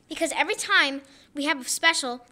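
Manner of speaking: ordinary talk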